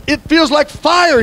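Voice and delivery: high, drawn-out preacher's cry; fervent prophet quote. Acted. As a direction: stretch it out with rising fervor. Preacher cries out prophet's words.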